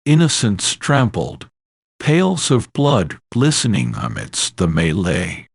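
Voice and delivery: plain reading voice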